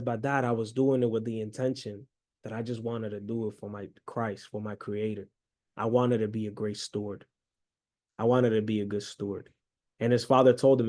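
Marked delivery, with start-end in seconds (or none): 0.0-9.5 low, hushed explanation to Dad; acted-out crestfallen son, softly spoken; mildly tearful. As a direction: plead quietly, a touch of tears. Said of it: "he just starts and tears up".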